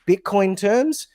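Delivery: "Bitcoin terms" drawn out slowly and pitched high; emphasis on Bitcoin terms. Real, not acted. Telling listeners to price everything in Bitcoin, stressing "Bitcoin terms". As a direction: lean hard on "Bitcoin terms", slow and lifted.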